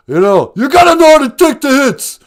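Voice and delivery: loud, high boxer holler; fired-up shout. Acted. Film star preaching toughness to the host, shouted. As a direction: shout it like a boxer's pep talk. Stallone character's fired-up motto.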